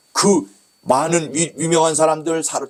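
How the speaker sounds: ordinary talk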